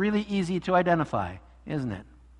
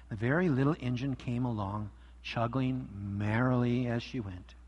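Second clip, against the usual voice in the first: story reading; 3 semitones lower than usual. A narrator's reading. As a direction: read it like story hour for kids. Pastor reads story aloud.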